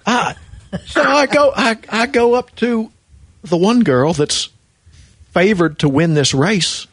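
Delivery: ordinary delivery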